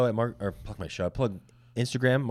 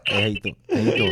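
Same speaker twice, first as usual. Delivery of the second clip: mob guy voice; faster than usual. Acted. Mob person bit.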